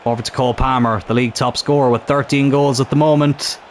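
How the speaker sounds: ordinary delivery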